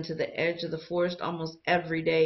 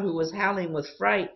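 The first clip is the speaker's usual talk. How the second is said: plain reading voice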